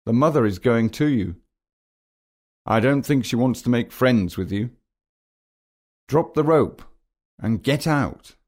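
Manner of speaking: mildly urgent, pitch climbing toward the end; rising alarm, lightly acted; urgent warning. A narrator's reading. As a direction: warn a friend and build to an order. Narrator shouts warning to Bill.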